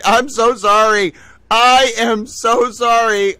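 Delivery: loud, drawn-out apology to chat; emphatic apology. Real, not acted. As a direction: beg forgiveness, drawn out.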